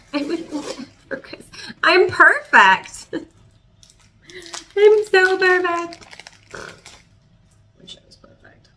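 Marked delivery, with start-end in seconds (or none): 1.8-6.1 high, slow, smug singsong; hammed-up self-praise, tongue in cheek; mock diva. Joking to viewers about being perfect, high smug mock voice. Self-mocking after giving advice. 7.8-8.7 breathy whisper, barely audible; whispered aside. Real, not acted. Confessing to viewers under her breath, whispered. Aside after mock bragging.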